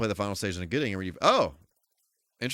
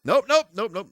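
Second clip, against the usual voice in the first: panicked nope; 10 semitones higher, 5 dB louder than usual. Real, not acted. Fleeing an enemy in game.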